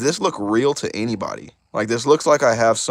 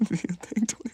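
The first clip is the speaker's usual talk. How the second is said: laughing; 10 semitones higher, 5 dB quieter than usual. Real, not acted. Laughing as he cheers a chat viewer on. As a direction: Do your thing said through laughter, higher.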